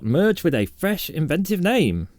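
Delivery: hint of a news anchor read; a touch of a formal headline read; newsreader voice. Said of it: "I gotta work on the newsreader voice".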